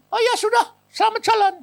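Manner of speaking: high-pitched, loud send-off; comic farewell. Retold or imitated. Preacher bids the spirit goodbye, comically high. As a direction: wave him off in a high voice, near laughter.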